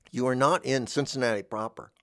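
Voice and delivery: ordinary talk, nothing marked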